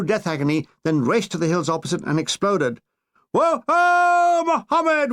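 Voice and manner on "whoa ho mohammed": high, loud whooping yell; battle cry yell. Retold or imitated. Troops cheering the downed plane, loud whooping battle cry. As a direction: whoop it out in triumph. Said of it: "we yelled".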